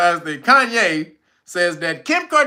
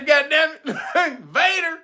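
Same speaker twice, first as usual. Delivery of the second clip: laughing; 7 semitones higher, slower than usual. Real, not acted. Chat suggestions crack him up.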